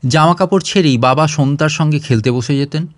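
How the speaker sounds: ordinary delivery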